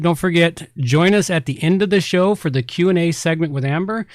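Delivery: normal talking voice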